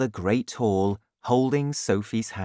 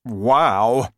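loud, delighted giant's wow; happy cry; 6 dB louder than usual. A narrator's reading. Giant delighted by his breakfast, happy exclamation. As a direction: cry it out happily. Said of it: "he cried happily".

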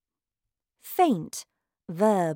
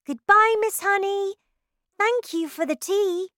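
much higher, louder call to her teacher; cheerful goodbye; 13 semitones higher, 5 dB louder than usual. A narrator's reading. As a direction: call it out happily as you run. Girl calls goodbye, running off.

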